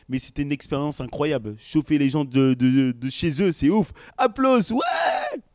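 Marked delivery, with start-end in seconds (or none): 4.2-5.4 hype shout. Shown as a demonstration. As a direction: fire up the crowd like a warm-up man. Warm-up man demoes crowd hype.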